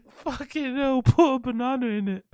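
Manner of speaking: cracking up while saying it; wheezing with laughter; laughing. Real, not acted. Still laughing at chat joke.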